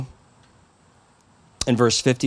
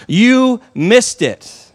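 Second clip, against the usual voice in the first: slow and louder, lifted high, every word hit; emphatic slow punch; 8 semitones higher, 5 dB louder, much slower than usual. Real, not acted. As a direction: land each word hard and slow. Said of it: "preaching climax".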